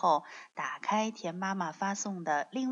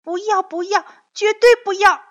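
put-on very loud high child's refusal; protesting child; 11 semitones higher, 12 dB louder, faster than usual. A narrator's reading. Young dinosaur refusing father's words, high shouted protest.

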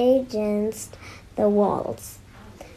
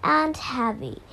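usual speaking voice

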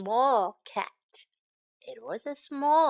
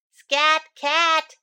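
louder, brisk shoo from the other cats; a firm "Scat Cat" punched out louder; 4 semitones higher, 8 dB louder than usual. Acted. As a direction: cats telling an intruder to leave.

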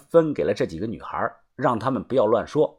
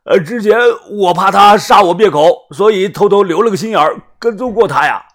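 higher, louder pleading explanation; desperate explaining; 7 semitones higher, 8 dB louder than usual. Acted. Captive explaining to his captors, high and loud with fear. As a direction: talk fast to save his life.

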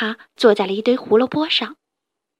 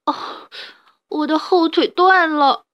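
in pain; 5 semitones higher, 3 dB louder, slower than usual. Acted. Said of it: "他痛苦地说".

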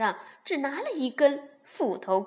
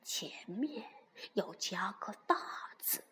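tiny whisper; 2 semitones lower, 8 dB quieter than usual. A narrator's reading. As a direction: whisper like a mosquito. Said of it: "用蚊子大小的声音".

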